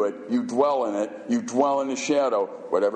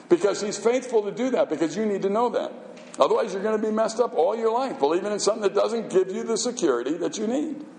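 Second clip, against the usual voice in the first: impassioned preaching; 8 semitones higher than usual. Acted. Preaching at full force.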